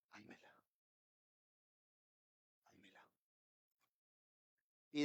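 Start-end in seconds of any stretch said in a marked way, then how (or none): 0.1-3.1 nearly breathless soft echo; hushed repetition. Retold or imitated. Preacher echoing the Lord's command, barely voiced. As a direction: let the words fade to a hush. God's command repeated, fading.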